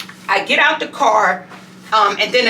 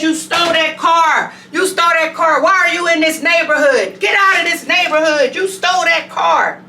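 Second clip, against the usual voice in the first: mock yell; 6 semitones higher than usual. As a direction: play the old lady shouting her out. Elderly woman yelling to leave.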